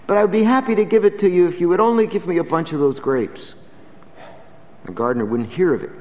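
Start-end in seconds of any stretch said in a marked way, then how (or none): 0.0-3.5 higher, mildly pleading mother; preacher voicing the mother begging for grapes, a touch of pleading. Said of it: "she pleaded".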